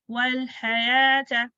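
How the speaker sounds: वल हयात very slow, long aa held; two-count elongation. Shown as a demonstration. Teacher models the long vowel, elongated recitation. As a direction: hold the aa in हयात on a level note. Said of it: "two counts".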